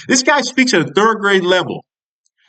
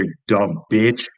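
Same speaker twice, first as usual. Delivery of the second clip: low contemptuous mutter; 11 semitones lower, 4 dB quieter, slower than usual. Real, not acted. Vlogger insulting Pam Bondi, low slow contemptuous mutter.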